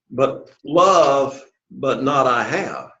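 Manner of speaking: "love" stretched long, then a break; emphasis on love. Shown as a demonstration. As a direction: hold "love" slowly before finishing the phrase. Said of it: "Notice here the emphasis".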